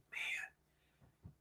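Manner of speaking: breathy hushed aside; barely-voiced man; whisper. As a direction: drop to a whisper on the first word. Retold plea to believers.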